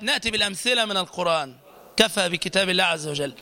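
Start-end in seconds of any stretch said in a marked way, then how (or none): none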